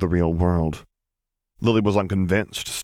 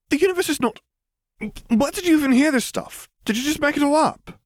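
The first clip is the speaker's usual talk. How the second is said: much higher, indignant squeal; offended schoolgirl attacking the strange claim, much higher; outraged girl. Acted. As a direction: burst out in huffy disbelief. Hermione outraged.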